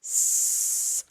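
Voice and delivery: unvoiced hiss; slow steady hiss out; long hissing s. Shown as a demonstration. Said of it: "on this S".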